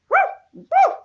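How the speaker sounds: high loud woof woof; playful dog bark. Shown as a demonstration. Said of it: "Not quite".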